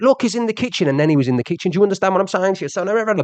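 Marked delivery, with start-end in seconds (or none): 0.0-0.9 higher, quick mock call; lightly voiced tip-off; imitating him. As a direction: pitch it up like a neighbour pointing him out. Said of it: "he went".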